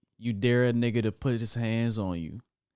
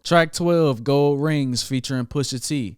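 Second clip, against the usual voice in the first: excited announcing; 5 semitones higher, 8 dB louder, slower than usual. Real, not acted. Announcing the next track.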